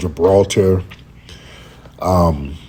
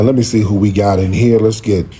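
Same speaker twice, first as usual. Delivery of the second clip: ordinary talk, nothing marked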